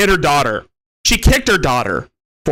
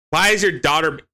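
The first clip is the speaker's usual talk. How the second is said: ordinary talk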